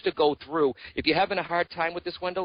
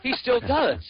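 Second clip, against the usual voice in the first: punchline said higher and louder, teasing; deadpan punchline; 9 semitones higher, 4 dB louder, slower than usual. Retold or imitated. Medium telling the caller a joke, cheeky punchline. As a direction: land the joke with a cheeky lift. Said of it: "And I said".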